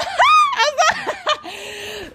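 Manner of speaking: sudden high squealing laugh; high shrieking laugh. Real, not acted. Cracking up at her own story, a high shriek of laughter. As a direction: burst out in a shrill cackle.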